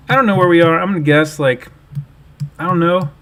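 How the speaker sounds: exasperated. Real, not acted. Stumped by a hard GeoGuessr round.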